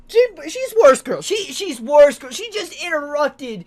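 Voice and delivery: high, loud, dragged-out complaint; indignant. Real, not acted. Player ranting that Monica just interrupted, indignant. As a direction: stammer crossly, stretching the ends.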